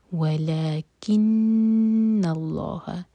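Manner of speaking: ولیکن held nasal on the doubled نون, heavy lam in اللہ; ghunna on nun. Shown as a demonstration. Teacher recites slowly and loud, holding the nasal. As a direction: hold the نون in ولیکن through the nose. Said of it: "تو نون کو hold کریں گے".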